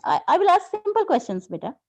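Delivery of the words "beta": ordinary talk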